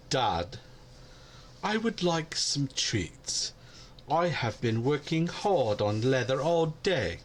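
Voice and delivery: slow, put-on cat voice asking Dad for treats; cat voice with an accent. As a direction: drag it out like a cat begging. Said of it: "why the accent?".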